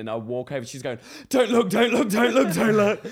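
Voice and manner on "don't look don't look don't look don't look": loud high panicked don't look; panicked pleading. Retold or imitated. Housemate panicking after spilling paint, loud high pleading. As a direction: beg them not to look, shrill with panic. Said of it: "like that".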